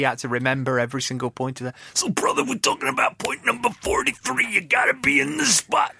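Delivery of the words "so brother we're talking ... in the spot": high gravelly wrestler growl; Macho Man impression. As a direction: growl it like Randy Savage. Impersonating Macho Man Savage.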